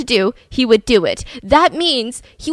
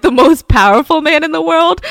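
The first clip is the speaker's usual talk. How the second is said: loud, higher disbelieving question; scoffing, raised-voice echo; incredulous; 4 semitones higher, 6 dB louder than usual. Real, not acted. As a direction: throw the boast back in disbelief. Host repeats the boast in disbelief.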